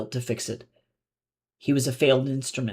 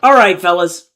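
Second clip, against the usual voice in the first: loud, bright chipper call; cheerfully rally the group; 4 semitones higher, 12 dB louder than usual. A narrator's reading. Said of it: "his voice chipper and the false accent gone".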